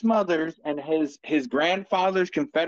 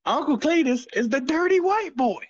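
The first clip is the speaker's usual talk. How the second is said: hillbilly announcer; 9 semitones higher than usual. Pretend.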